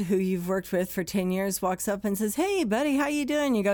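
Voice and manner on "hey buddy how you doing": high, bright hello from a coworker; cheery greeting. Retold or imitated. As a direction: sing out a friendly hey buddy.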